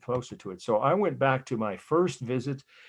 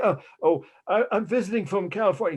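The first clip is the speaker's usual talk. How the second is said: higher, flustered startled reply; mock nervous tourist caught trespassing; startled stammer; 9 semitones higher, 5 dB louder than usual. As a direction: stammer it out like someone just woken by a guard. Startled tourist answers guard.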